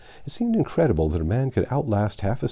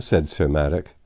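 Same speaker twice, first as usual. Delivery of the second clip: plain reading voice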